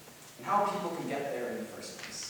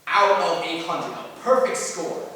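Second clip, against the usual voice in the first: slow, louder announcement of a triumph; slow, emphatic boast; proud proclamation; 7 dB louder, slower than usual. Acted. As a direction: draw it out proudly and project. Boasting of a perfect test result.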